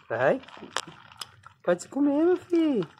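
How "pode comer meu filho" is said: much higher and louder, calling the little one to eat; high coaxing pet voice. Real, not acted. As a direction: sing it up high to coax the pet.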